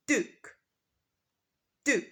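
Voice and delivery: Duke with a clear D, dook; American Duke, plain D; American pronunciation. Shown as a demonstration. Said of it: "in American English, this is pronounced".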